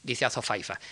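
ordinary delivery

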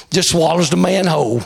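usual speaking voice

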